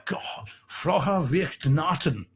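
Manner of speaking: slowly sounding out Frohe Weihnachten in German; careful German. Real, not acted. Reading a viewer's German greeting aloud, slow careful German. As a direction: read Frohe Weihnachten slowly, trying each German syllable. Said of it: "Holger, I love it".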